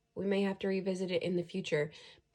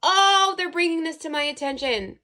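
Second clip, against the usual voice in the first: loud, high burst of excitement; real high-pitched outburst; excited realisation; 9 semitones higher, 13 dB louder than usual. Real, not acted. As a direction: exclaim it as a sudden revelation. Sudden realisation about the card.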